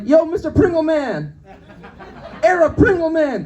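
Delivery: high, loud imitated call; mock heckling holler. Passenger in next car heckles comedian, mock holler. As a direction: holler it high at a passing stranger.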